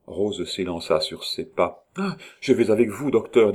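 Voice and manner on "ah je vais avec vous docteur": higher, louder urgent call. A narrator's reading. Rose hurrying after the departing doctor, urgent call. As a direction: call out as you rush after.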